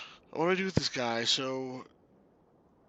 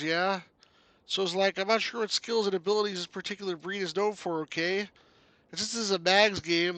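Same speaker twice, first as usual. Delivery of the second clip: higher-pitched, folksy Canadian game character; chatty Canuck game hero; 7 semitones higher, 7 dB louder than usual. Acted. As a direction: play a chirpy Canuck explaining themselves, eh.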